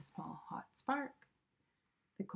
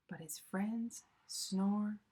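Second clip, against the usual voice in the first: slow whispered ending; much slower than usual. A narrator's reading. Closing line of bedtime story.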